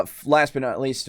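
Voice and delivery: normal talking voice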